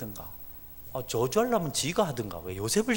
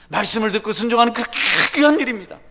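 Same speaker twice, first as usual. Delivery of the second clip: high, loud, fervent sermon; full-force preaching; 10 semitones higher, 12 dB louder, faster than usual. Acted. Pastor exhorting the congregation, loud fervent preaching. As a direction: preach it out to the rafters.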